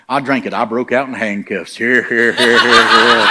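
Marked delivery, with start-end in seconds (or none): 1.8-3.3 laughing. Real, not acted. Laughing at own joke.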